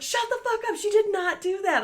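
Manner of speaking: very high, incredulous squeal; shocked disbelief. Retold or imitated. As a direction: gasp it out in disbelief. Friend Nikki's disbelief.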